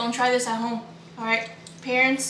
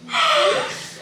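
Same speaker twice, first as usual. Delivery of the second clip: sharp gasp. Real, not acted. Reacts to joke.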